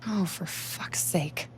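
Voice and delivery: whispered under the breath, slow; exasperated whisper. Acted. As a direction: sigh it out in a whisper.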